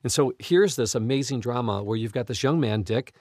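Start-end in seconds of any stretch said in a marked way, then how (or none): none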